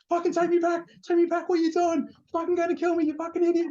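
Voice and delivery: high panicky mock rant at the reckless driver; panicked yelling. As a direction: play the terrified guy demanding to be taken back. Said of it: "he starts going mental".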